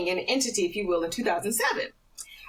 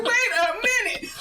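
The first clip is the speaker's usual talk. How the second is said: laughing double take; 13 semitones higher, 4 dB louder than usual. Retold or imitated. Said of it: "I'm like".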